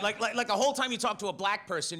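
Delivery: usual speaking voice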